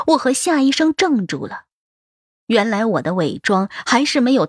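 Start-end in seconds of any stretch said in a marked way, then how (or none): none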